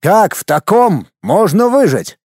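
high, slow incredulous question; stunned, drawn-out high question; shocked. A narrator's reading. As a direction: stress it word by word in disbelief. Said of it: "в шоке высказался Цаплин".

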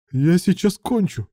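high-pitched, urgent outburst; urgent high cry. A narrator's reading. Aroused young man to his lover, urgent and high.

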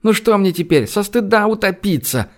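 high-pitched indignant retort; exasperated. A narrator's reading. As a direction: throw it back as a pleading protest. Upset woman answering in quarrel. (возмущённо, высоким голосом)

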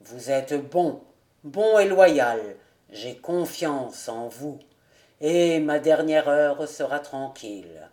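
low, grave, restrained feeling; solemn trust with emotion held back; suppressed emotion. A narrator's reading. Old nobleman trusts his loyal cousin, restrained and grave. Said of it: "en refoulant son émotion au-dedans de soi".

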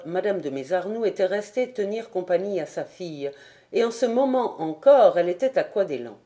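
ordinary delivery, nothing marked

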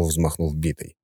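ordinary delivery

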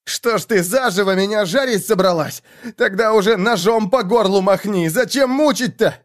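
high, agitated reproach; angry protest. A narrator's reading. Man roasted alive berates his captor, angry and desperate.

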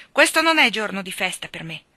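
loud curt retort; snapping. A narrator's reading. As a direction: brush him off. Husband snaps at sweep. (risposta secca e forte)

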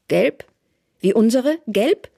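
high, loud, sharply rising questions; taunting question. A narrator's reading. Therese questioning the informer, mockingly insistent. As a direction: throw each question upward at him.

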